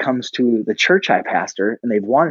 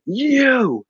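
high, drawn-out accusing call; mock yell; 13 semitones higher than usual. Angry man pointing at the speaker, high mock yell. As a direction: imitate a man calling someone out. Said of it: "why is he yelling".

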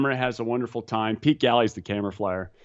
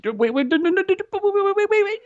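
very high frantic babble, building louder; mock panic; 3 dB louder, faster than usual. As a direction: imitate a terrified student pleading in a squeal. Retold panicked student on tandem jump.

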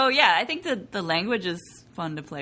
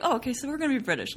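hasty assumption; 6 semitones higher, much faster than usual. Retold or imitated. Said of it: "they're always like".

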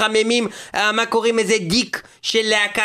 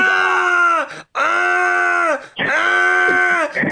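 shouting; 8 semitones higher, much slower than usual. Acted. Host yelling.

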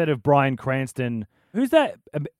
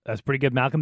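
usual speaking voice

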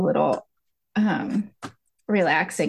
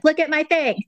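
put-on high-pitched child showing off; proud little child; 9 semitones higher than usual. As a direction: pipe up like a kid with artwork. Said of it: "like a child holding up the macaroni".

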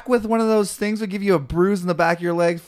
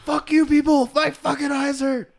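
put-on high drunk yell; 12 semitones higher than usual. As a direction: wail like a sprayed drunk. Repeating drunk's screams.

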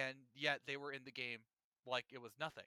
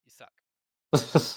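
mock insult; 3 semitones lower, 17 dB louder than usual. Real, not acted. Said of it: "First of all, Chris Pratt".

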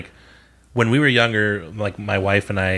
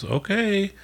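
stranger's reply; 11 semitones higher than usual. Retold or imitated. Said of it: "And he goes".

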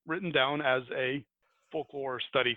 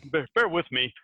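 ordinary talk, nothing marked

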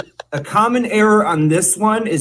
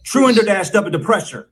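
brisk, higher "Truender dash dubba depressure"; a little more quickly; 4 semitones higher, faster than usual. Shown as a demonstration. Mock teacher speeding up the drill for students, quicker. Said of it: "Say it a little more quickly".

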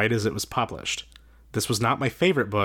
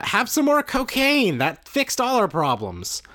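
eager Lord; 12 semitones higher, slower than usual. Retold or imitated. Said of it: "exactly like that. Like".

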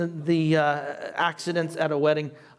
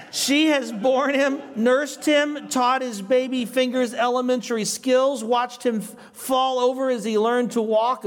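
higher-pitched, emphatic reading of a quotation; reading aloud; 7 semitones higher than usual. Acted. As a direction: read the commentary out with lifted pitch. Reads a commentary quote aloud.

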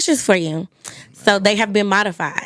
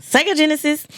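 excited teasing; 10 semitones higher than usual. Real, not acted. Host teasing joke answer.

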